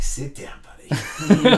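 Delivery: whispered sit down, then a high, loud, laughing buddy; brushing off a showy drummer; mock dismissive. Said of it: "it's like".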